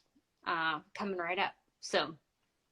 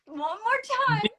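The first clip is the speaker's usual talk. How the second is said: disbelieving request to repeat, higher; 14 semitones higher, 4 dB louder than usual. Retold or imitated. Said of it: "And I'm like".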